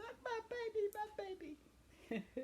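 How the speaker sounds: proud mama. Retold or imitated. Grandmother imitating her mama's proud cooing, high and tearful. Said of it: "our mamas were proud of us".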